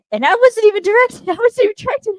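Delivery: high-pitched fangirl outburst; giddy excitement. Real, not acted. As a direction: gush it high, breaking into a laugh. Gushing, laughing over the line.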